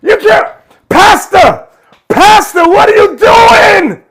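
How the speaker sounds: high, loud, slow disbelief; exasperated shout. Real, not acted. Host exasperated at pastor.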